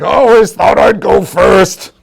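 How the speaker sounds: high, loud, slow tearful wail; wailing monster grief; crying bugbear. Acted. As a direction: sob it out over a lost friend. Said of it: "the bugbear's like".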